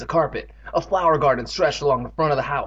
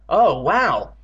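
louder amazed exclamation; exclaimed; 3 semitones higher, 5 dB louder than usual. A narrator's reading. Girl seeing the bucket's contents, exclaiming. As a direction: take in a startling sight. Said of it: "Andy exclaimed".

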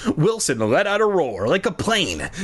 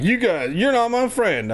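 mock confrontation; 9 semitones higher than usual. Imagining confronting friends who kept a secret, mock complaint. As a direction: put on a feeble confrontation. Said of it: "that kind of confrontation of me being like".

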